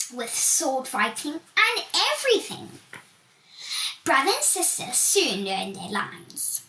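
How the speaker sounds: plain reading voice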